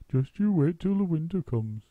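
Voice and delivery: lightly voiced bird; a friendly bird's lilt; chirpy roly-poly bird. A narrator's reading. Roly-poly bird warning Muggle Wump gently.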